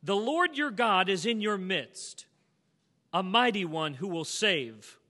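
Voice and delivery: slow, loud proclaimed scripture; booming pulpit reading of the verse. Acted. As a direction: read it out like a proclamation. Reading scripture aloud in sermon.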